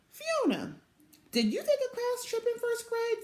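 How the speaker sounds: put-on high, eager little girl; Velma's question. A narrator's reading. Little sister asking big sister about trips, high eager voice. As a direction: lift into a small kid's hopeful ask.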